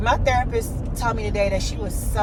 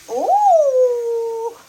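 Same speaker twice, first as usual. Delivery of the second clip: excited ooh; 4 dB louder than usual. Real, not acted. Mom opening gift with baby.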